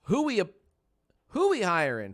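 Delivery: loud game-show host call; hype shout. Acted. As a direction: announce it big and loud. Streamer hyping poll question.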